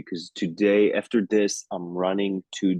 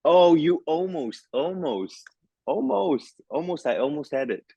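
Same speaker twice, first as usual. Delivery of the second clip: mock heartbreak; 9 semitones higher than usual. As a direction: wail each almost in playful anguish. Mock heartbreak at ranking second.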